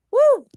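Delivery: loud high whoop of joy; excited whoop. Real, not acted. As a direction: let out a sudden loud, high woo. Excited cheer mid-story.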